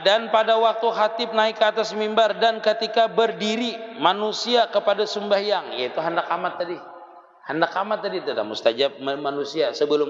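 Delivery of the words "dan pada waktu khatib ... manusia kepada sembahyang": higher, sustained recitation tone; formal kitab recitation; reading religious text aloud. Acted. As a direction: read out the text in a raised, measured chant-like tone.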